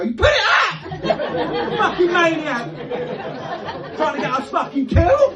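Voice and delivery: high, slow furious mock shouting at a driver; hammed-up outrage at a harmless glove compartment; mock road rage. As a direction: rant like a maniac over a glove box. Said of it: "you wouldn't go".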